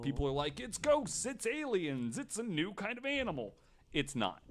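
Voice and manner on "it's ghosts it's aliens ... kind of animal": mock excited believer. Mocking believers who claim skyfish are ghosts, hammed-up high excitement. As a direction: gush like a wide-eyed believer.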